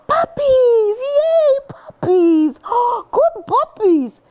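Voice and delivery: very high, excited praise with gasps; squealing happy puppy praise. Shown as a demonstration. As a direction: gush over the puppies. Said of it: "that high, happy voice".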